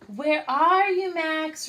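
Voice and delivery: loud, high calling for her brother. Acted. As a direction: call across the garden. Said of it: "Ruby called".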